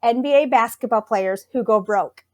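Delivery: ordinary talk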